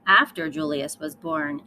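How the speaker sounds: plain reading voice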